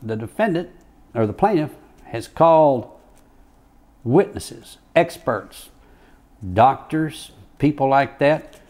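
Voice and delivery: a hint of a pointed judge drawing out chiropractor; imitating the judge. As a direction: judge warning the jury about the paid chiropractor. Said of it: "and he says".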